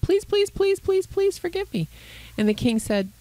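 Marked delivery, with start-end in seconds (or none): 0.0-1.9 high, desperate pleading, each please climbing; begging servant. Acted. Servant begs the king to forgive his debt, high desperate pleading. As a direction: plead like a ruined man on his knees. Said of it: "he started to beg".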